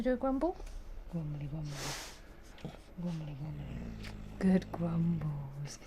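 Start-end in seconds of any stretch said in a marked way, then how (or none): 1.1-4.1 lower, soft growly mimicry; soft dog grumble talk. Real, not acted. Owner mimicking the dog's grumble, soft and low. 4.4-5.8 slow whispered praise to the dog. Real, not acted. Owner whispering praise to the grumbling dog.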